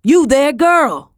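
high, loud shout after her; calling out. Acted. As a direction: shout across the street. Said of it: "A man called after me".

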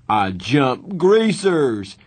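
loud, high, slow mock scream. A narrator's reading. Two-Bit screams his punchline doing a cartwheel. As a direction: yell it out proudly. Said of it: "Two-Bit screamed".

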